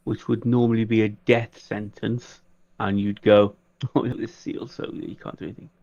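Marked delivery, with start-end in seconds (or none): none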